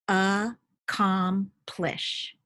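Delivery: slow, split into three syllables; teacher feeding syllables to a student, slow. Shown as a demonstration. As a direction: give accomplish as ac-com-plish, one syllable at a time. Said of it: "I'm gonna give you three syllables".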